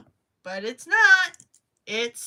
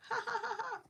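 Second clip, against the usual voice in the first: laughing; 12 semitones higher, 8 dB quieter, faster than usual. Real, not acted. Laughing at herself while crocheting.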